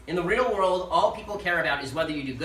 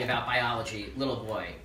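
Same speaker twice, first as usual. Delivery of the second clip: impersonating Zoe with a much lower voice, dropping quieter at the end; octave-low voice; 6 semitones lower, 5 dB quieter than usual. Retold or imitated. Zoe taunting the speaker on TV, lower, deep voice. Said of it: "in a voice at least an octave lower than mine".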